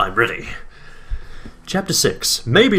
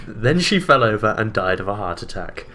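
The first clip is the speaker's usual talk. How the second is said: ordinary delivery, nothing marked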